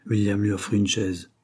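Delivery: ordinary delivery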